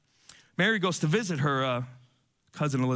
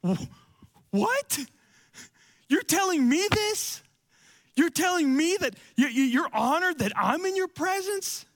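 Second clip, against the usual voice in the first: stunned disbelief; 11 semitones higher than usual. Retold or imitated. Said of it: "And Mary's like".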